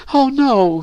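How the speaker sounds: high falsetto wail of dismay; theatrical high-pitched wail; howling. A narrator's reading. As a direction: howl it in a girl's high voice. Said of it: "howled Elizabeth".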